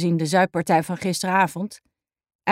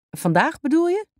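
puzzled man's question; 8 semitones higher than usual. A narrator's reading. Jack's voice in dialogue.

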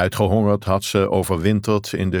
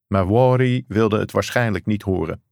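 plain reading voice

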